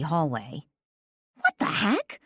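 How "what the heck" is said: much higher, startled outburst; startled cry. A narrator's reading. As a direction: cry out in shock. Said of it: "I cried".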